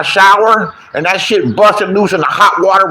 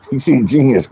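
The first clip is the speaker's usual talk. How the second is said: normal talking voice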